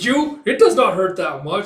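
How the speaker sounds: loud, high, drawn-out retort; exasperated scolding. Retold or imitated. Parent arguing with child.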